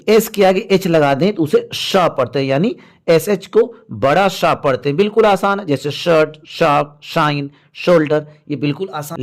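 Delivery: ordinary talk, nothing marked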